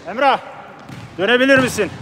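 high call across the field. Real, not acted. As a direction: shout to a player on the pitch. Calls out to player on pitch. (sahadaki oyuncuya seslenerek)

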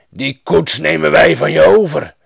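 a highway robber's threatening shout; angry robbers. A narrator's reading. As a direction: demand it loudly like a menacing bandit. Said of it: "boze rovers".

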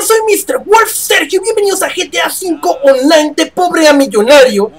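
high, upbeat channel intro; YouTuber intro. Acted. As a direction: greet viewers like a hype host.

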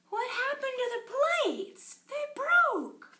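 high, exclaimed storybook dismay; exaggerated high-voiced shock for children; mock dismay. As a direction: react high-pitched to a little disaster. Acted reaction to broken plates.

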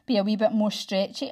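plain reading voice

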